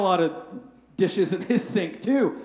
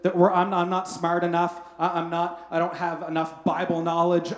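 ordinary talk, nothing marked

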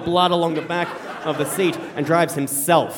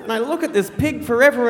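ordinary talk

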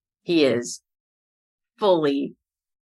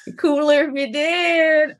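playful echo; 10 semitones higher than usual. Retold or imitated. Echoes the listener's remark.

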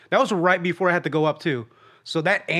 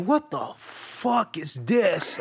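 slow, soft, drawn-out mock anger; mock angry outburst; 5 dB quieter, much slower than usual. Friend opening the food bag, slow drawn-out mock anger. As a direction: drag it out like a disgusted complaint. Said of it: "the anger and the emotion".

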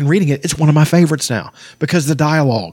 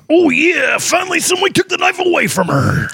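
very high strained wrestler shout; hammed-up wrestler roar, held high all through; Macho Man Randy Savage. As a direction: bellow it like a pro-wrestler cutting a promo. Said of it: "Read it like Macho Man Randy Savage".

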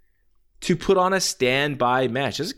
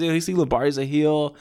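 normal talking voice

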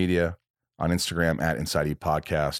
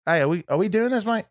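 higher-pitched imitated Australian accent; Jim Jeffries; 13 semitones higher, faster than usual. Guest checks on the booking a week before, imitated Australian accent. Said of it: "wants to do the Australian accent".

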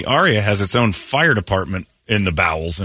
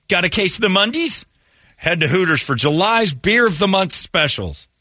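higher, bright ad announcer voice; ad read; 10 semitones higher than usual. Acted. Host reads the Hooters sponsor spot, announcer style. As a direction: sell it like a radio spot.

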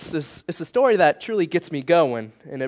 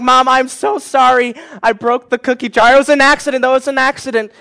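higher and louder pleading, half-acted sobbing kid; mock tearful apology; 7 semitones higher, 6 dB louder than usual. Boy apologizing to mom for the broken jar, mock tearful pleading. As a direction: beg Mom's forgiveness like a guilty kid. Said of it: "I was like".

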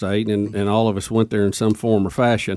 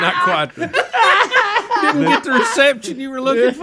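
laughing; slower than usual. Real, not acted. Laughing at failed name-drop.

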